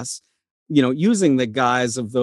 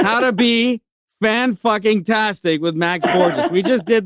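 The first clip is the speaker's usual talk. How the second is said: laughing; 6 semitones higher than usual. Real, not acted. Reads book title, laughing.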